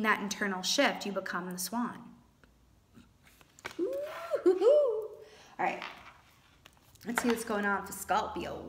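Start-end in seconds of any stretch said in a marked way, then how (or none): 3.8-5.1 delighted hooting laugh. Real, not acted. Delight after swan reading.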